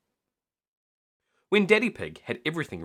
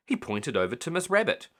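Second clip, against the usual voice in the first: ordinary delivery, nothing marked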